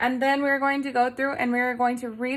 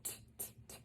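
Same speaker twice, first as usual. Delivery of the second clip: whispered sound; 29 dB quieter, much faster than usual. Shown as a demonstration. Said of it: "What do you hear first?".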